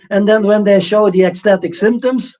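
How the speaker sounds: ordinary talk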